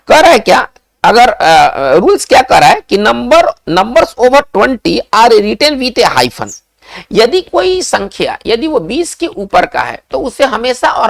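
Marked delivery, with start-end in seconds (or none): 3.7-6.6 very slow, word by word dictation; reading the rule. Shown as a demonstration. Teacher slowly reads the hyphen rule to students. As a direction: spell out the rule slowly for the class.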